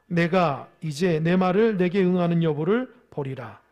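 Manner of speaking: ordinary delivery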